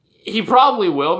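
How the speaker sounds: normal talking voice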